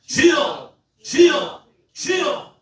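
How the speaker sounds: high, full-force prayer shout; loud, high cry to the Lord; crying out loudly. Shown as a demonstration. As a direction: roar it out to rally the congregation. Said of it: "소리를 크게 함성을 지르며 외치는 거예요".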